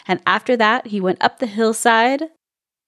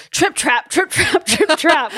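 high, emphatic hoofbeats; hammed-up big-goat stomping, pitched up; all caps; 8 semitones higher than usual. A narrator's reading. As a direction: chant it high, as if in capitals. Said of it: "and in all caps".

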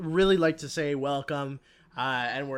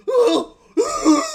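much higher, much louder mock screams; imitated screaming; 15 dB louder than usual. Retelling the movie, he imitates a character screaming. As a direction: belt out a hammed-up scream of horror. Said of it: "screaming like that".

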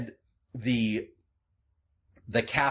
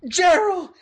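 much higher, loud overjoyed cry; shouting name; 9 dB louder than usual. Acted. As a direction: burst in yelling his friend's name. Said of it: "the round man comes bursting through".

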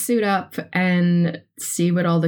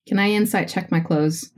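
ordinary delivery

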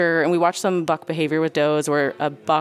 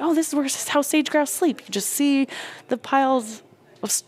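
mock wide-eyed nature guide; 10 semitones higher than usual. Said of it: "I was like".

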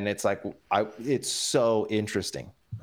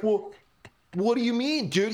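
much higher, baffled comeback; taken-aback protest; 11 semitones higher than usual. Retold or imitated. Friend answers the misread text, baffled and offended. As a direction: protest in a high, incredulous pitch. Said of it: "He's like".

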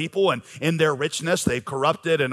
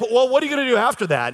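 quick, higher, eager reporter's question; pressing reporter; 8 semitones higher, 3 dB louder, faster than usual. Retold or imitated. Preacher imitating reporters pressing the quarterback, quick and eager. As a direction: push him for more like a keen reporter.

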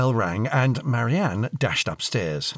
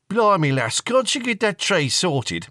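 loud, raised voice, teasing his daughter; higher, louder fatherly grumble; exasperated dad; 10 semitones higher, 5 dB louder than usual. Acted. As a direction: bluster like a dad across the living room.

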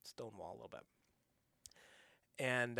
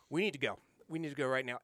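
louder, pressing urgency; urgent retold insistence; 2 semitones higher, 9 dB louder, faster than usual. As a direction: push everyone to leave now. Friends urge fleeing.

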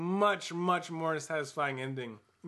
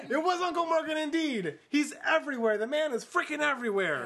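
high, loud, laughing amazement; excited delight; 10 semitones higher, 4 dB louder than usual. Real, not acted. Narrator confirms the surprise rescuer, excitedly. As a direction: crow about it at the top of your range.

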